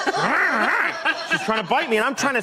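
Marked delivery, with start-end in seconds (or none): 0.1-0.9 higher playful imitation of a snarling dog; imitating the dog turning vicious, mock growl. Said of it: "She became completely vicious".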